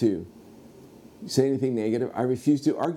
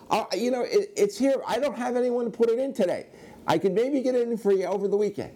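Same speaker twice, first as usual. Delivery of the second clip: high-pitched, strained excuses; flustered shop owner; 12 semitones higher than usual. Retold or imitated.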